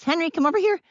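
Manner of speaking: high, bright-pitched dog call; calling him like this. Shown as a demonstration. Owner showing how she guides Henry, high coaxing call. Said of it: "I have to call him Henry like this".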